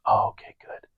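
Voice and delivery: whispered, breathy okay good; mock soft lady. Stranger after a show, softly whispering. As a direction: hush it like a sweet churchgoer. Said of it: "She goes".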